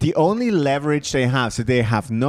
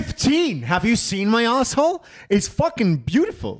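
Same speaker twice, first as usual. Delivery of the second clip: mock boasting; 8 semitones higher than usual. Mock boasting about his own asshole.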